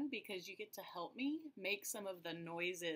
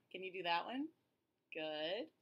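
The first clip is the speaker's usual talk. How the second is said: plain reading voice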